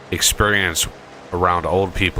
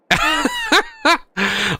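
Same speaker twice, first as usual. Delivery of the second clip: a sudden, squeaky burst of laughter; high laugh. Real, not acted. Laughing high while telling a friend about the mall. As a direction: laugh high at how absurd it was.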